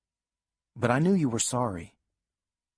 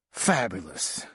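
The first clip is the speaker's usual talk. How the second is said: huffy; 5 semitones lower, slower than usual. Retold or imitated. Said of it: "all huffy".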